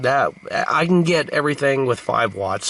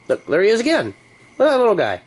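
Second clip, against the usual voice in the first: higher, louder delighted exclaim; excited; 10 semitones higher, 4 dB louder, much faster than usual. Real, not acted. Spotting the bug on the lampshade, excited and high. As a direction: point it out with glee.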